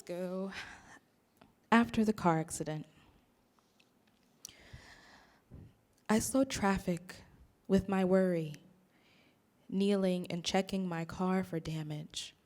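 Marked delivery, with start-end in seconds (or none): 1.7-12.3 deliberate recital, phrase by phrase; poetry reading. Acted. Poet reading a poem after the car accident, measured poetry reading. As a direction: read it as verse with pauses between lines.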